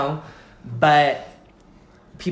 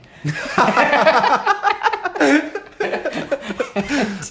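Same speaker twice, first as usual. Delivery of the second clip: loud high laugh; 14 semitones higher, 6 dB louder than usual. Real, not acted. Laughing hard at her own story. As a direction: burst of high cackling laughter. Big laugh at buffet joke.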